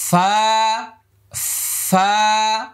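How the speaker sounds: airy ফা held one beat; airy letter demo. Shown as a demonstration. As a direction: breathe out ফা between teeth and lip. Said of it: "দাঁত দেখা যাবে এইভাবে".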